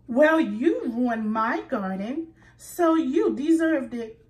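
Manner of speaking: loud, defiant comeback; indignant retort. A narrator's reading. Squirrel snapping back at Rabbit, loud and indignant. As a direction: throw the blame back. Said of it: "said Squirrel".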